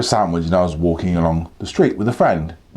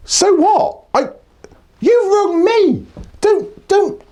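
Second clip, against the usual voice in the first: exasperated; slower than usual. Retold or imitated. Exasperated at the scam caller.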